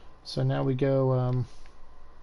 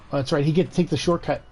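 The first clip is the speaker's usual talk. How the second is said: normal talking voice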